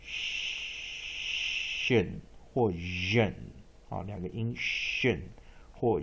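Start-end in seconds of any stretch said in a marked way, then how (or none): 0.0-3.3 "shen" and "忍" said very slowly as two options; slow teacher demo; two readings. Shown as a demonstration. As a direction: draw out "shen" then "忍" slowly. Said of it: "它可以念".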